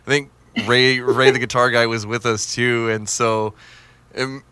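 ordinary talk

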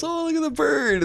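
high, cooing sister-in-law voice. Retold or imitated. Telling the parrot story, sister-in-law coos in a high voice. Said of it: "she gets down and goes".

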